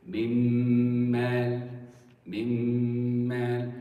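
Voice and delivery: "Mimmal" with a long, loud hummed m; ghunna without nun. Shown as a demonstration. Teacher showing the merged nun, long nasal m in "Mimmal". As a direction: hold the double m of "Mimmal" on one level note. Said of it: "You don't hear the nun".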